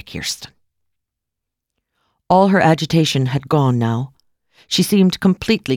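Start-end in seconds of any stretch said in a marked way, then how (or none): 0.0-0.5 hushed, whispered address; whispered name. A narrator's reading. Medium addresses Kirsten by name.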